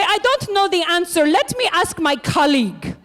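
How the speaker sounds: put-on hotel clerk in a made-up European accent, slowing on colleague; lightly put-on made-up European accent; a touch of made-up European accent, polite and helpless. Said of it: "like, an accent".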